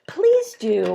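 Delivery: usual speaking voice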